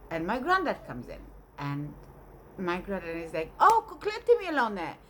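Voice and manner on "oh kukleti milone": high, loud mock outburst; hammed-up loud exclamation; granddad's exclamation. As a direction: cry it out high, then let it fall. Granddad exclaims on seeing the food.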